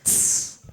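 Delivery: short unvoiced hi-hat hiss tss; beatbox hi-hat tss. Shown as a demonstration. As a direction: make a crisp hi-hat tss with teeth. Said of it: "It sounds like this".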